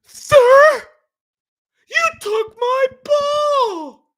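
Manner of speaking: high, very slow wailing shout; mocking Queen Karen. Vlogger mocking Karen demanding her ball back, high shrill wail. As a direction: drag out a shrill high entitled whine. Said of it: "Queen Karen".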